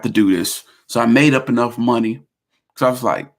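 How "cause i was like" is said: usual speaking voice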